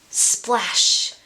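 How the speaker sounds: ordinary delivery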